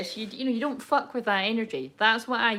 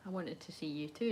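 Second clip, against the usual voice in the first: hushed, low-pitched intimate reply; vulnerable; 5 semitones lower, 14 dB quieter than usual. Retold or imitated. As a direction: answer very softly, voicing his confession. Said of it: "It's actually quite vulnerable".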